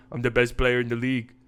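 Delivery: low, cocky star-player voice; Luka Doncic. Acted. Superstar boasting as he brings the ball up, cocky impression. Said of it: "Luka Doncic comes down the floor".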